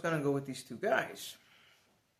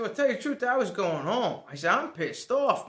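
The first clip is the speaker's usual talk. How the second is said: loud, higher, angry venting; fuming; 8 semitones higher, 10 dB louder than usual. Retold or imitated. In the back seat, venting to coworkers, loud and angry. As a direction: let the anger spill out. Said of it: "I'm fuming".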